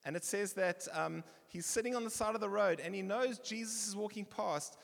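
ordinary talk

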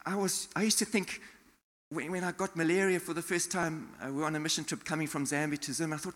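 ordinary talk

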